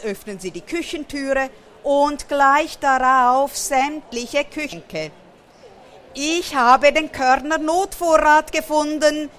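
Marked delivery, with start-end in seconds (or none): 6.1-9.3 higher, louder cry of delight; exultant storybook character voice; jubilant. A narrator's reading. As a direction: cheer over a great find.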